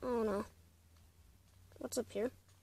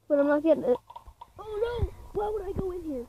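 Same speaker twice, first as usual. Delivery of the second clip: ordinary talk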